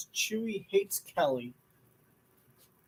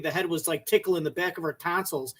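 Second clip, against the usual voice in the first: ordinary talk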